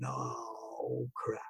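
a hushed, sinking "oh crap"; dreading under breath. Retold or imitated. Son seeing his mom waiting on the sofa, hushed dread. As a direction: mutter it to yourself, caught.

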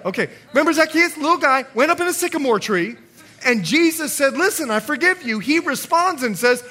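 loud, driving pulpit preaching; full-force preaching. Acted. Pastor urging the church toward godly sorrow, preaching loud. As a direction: preach it hard to the congregation.

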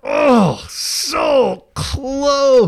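high, loud wail of near miss; dismayed wail. Real, not acted. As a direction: groan it out, so close. Missed guess, wails in dismay.

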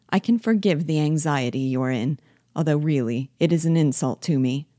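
ordinary delivery, nothing marked

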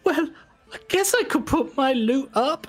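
high, hesitant giving in; tempted gambler girl. Acted. Girl deciding to bet her loot, high hesitant voice. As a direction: talk yourself into it. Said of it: "she's like".

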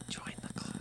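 spooky whisper. Pretend. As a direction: breathe it like a ghost. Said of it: "this might be more effective".

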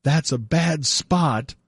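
slow, drawn-out "That's a bad spot."; slow motion. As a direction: stretch every word out slowly. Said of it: "in slow motion saying".

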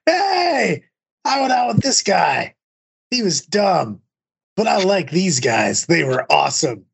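high, drawn-out holler sinking toward normal pitch; imitated drunken friend. Imitating a friend barging in at midnight, drunken holler. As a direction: burst in calling out, then let it wind down.

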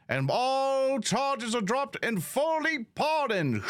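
high, projected herald's proclamation; official proclaiming a pardon. Acted. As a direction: proclaim it to the crowd, pitched high. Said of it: "And it's like".

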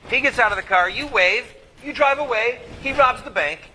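ordinary talk, nothing marked

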